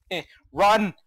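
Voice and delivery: high, loud panicked cries with nervous laughter; real panic. Real, not acted. Player running from monsters, panicked. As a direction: yell it as you flee for your life.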